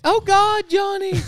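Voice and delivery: high breathy mock moan. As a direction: squeal it like a fake moan. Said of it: "you could record her saying".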